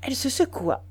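usual speaking voice

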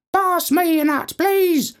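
very high chirpy parakeet. Acted. Famous parakeet asking for a nut, high parakeet voice. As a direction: squeak it like a trained parakeet. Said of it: "taught a parakeet to say things like".

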